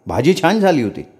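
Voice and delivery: ordinary delivery, nothing marked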